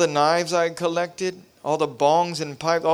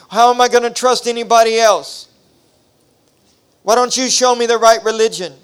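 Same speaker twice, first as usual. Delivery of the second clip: higher, louder, hurling accusations; angry cursing; 5 semitones higher, 8 dB louder than usual. Retold or imitated. Teen cursing God alone, raised angry voice. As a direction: shout every grievance up at the sky. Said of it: "I started cursing at God".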